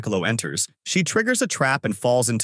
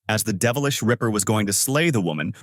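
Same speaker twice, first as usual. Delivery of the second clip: plain reading voice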